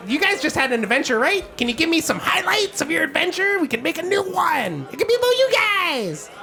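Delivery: high, fast, excited gnome begging for a song; Gilly the gnome. Acted. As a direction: pile on the excitement, words tumbling out. GM voices Gilly pleading.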